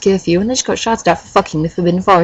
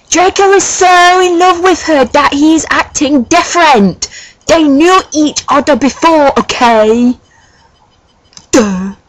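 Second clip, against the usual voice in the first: petulant author; 6 semitones higher than usual. Acted. Author telling flamers off in her note, high petulant rant. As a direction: complain in a high, pouty put-on voice.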